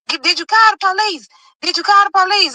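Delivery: high urgent mother's voice; worried mom. Retold or imitated. Mom on the phone asking about police, high and urgent. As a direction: mom pressing hard on the phone.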